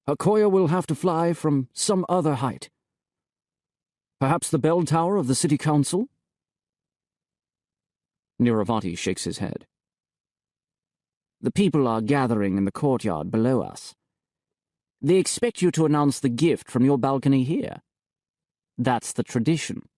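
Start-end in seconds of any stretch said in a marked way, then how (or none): 0.1-6.1 a hint of the high priest, explaining; slightly raised voice for the priest; high priest justifying himself, lightly voiced. Vasudeva voiced in the story. 11.4-19.9 a hint of the bishop, smug and cool; the bishop reporting to the high priest, lightly voiced. Niravati voiced in the story.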